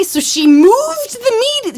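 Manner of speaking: "moved" punched high and slow, indignant; stress on moved. Real, not acted. Indignant about the moved party.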